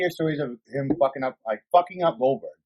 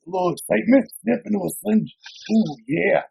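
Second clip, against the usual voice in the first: Macho Man; 6 semitones higher than usual. Retold or imitated. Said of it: "He was like".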